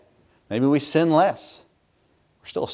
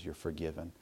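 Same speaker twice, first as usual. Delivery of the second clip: low, very soft reassurance; hushed, calm forgiveness; gentle absolution; 9 semitones lower, 21 dB quieter than usual. Retold or imitated. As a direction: pardon them quietly. God's gentle answer voiced.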